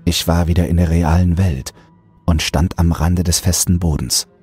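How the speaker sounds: ordinary delivery, nothing marked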